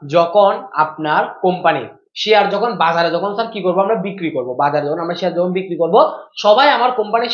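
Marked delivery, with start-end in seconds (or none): 0.1-3.1 very slow, word-by-word explaining; deliberately slow. Real, not acted. Teacher resuming the explanation for students, slowly. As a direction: spell the idea out at half pace. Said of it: "আস্তে আস্তে বলতেছি".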